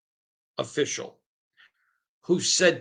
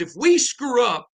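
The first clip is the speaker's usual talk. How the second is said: raised, higher mock yell of the ref's words; retold ref's shout; 7 semitones higher, 4 dB louder than usual. Host retelling a ref friend's outburst, loud and high. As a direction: belt it out like the ref did. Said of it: "He goes".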